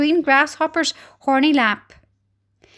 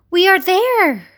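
raised, hopeful announcement; trying to be cheerful; 5 semitones higher, 4 dB louder, slower than usual. A narrator's reading. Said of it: "trying to be cheerful".

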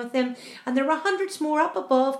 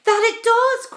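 high, loud wail of agreement; crying out; 12 semitones higher, 10 dB louder, slower than usual. A narrator's reading. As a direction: cry it out in alarm. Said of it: "cried the earthworm".

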